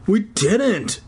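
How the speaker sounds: normal talking voice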